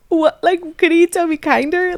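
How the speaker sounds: high, loud, indignant; indignant surprise. Retold or imitated. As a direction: raise it in disbelief. Indignant self quote.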